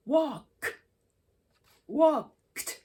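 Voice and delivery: "walked" ending in a crisp T sound, wokt. Shown as a demonstration. Teacher showing students the ED ending said as T. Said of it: "Now it becomes T".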